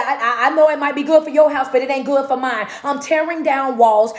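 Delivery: usual speaking voice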